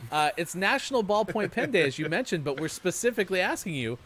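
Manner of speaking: "National Ballpoint Pen Day" said high and loud; excited host. Shown as a demonstration. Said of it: "Can I get a rewind".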